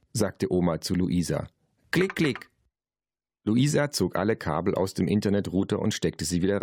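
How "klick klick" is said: high-pitched spoken clicks; grandma clicking. A narrator's reading. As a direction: imitate mouse clicks in a high voice. Narrator voices grandma's computer clicks. (hohes „Klick, klick“ der Oma)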